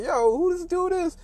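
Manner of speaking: very high, drawn-out imitation of hecklers; mocking amused disbelief. Players laughing at the cocky newcomer, mocking disbelief. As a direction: jeer in a slow, squeaky-high voice. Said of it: "and they're laughing. They're like".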